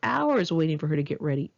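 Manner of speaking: plain reading voice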